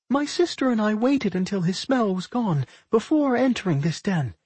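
high-pitched pup voice; talking pup. A narrator's reading. Pup explains to Millie how they got in, high creature voice.

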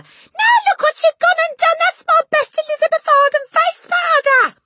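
very high, loud wail of outrage; outraged shriek. A narrator's reading. Mother over spilled powder, outraged shriek. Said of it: "shrieked the mother".

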